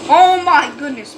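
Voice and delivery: loud, high shout of real shock; startled shout. Real, not acted. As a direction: yell out at the sudden attack. Tank suddenly attacked in game.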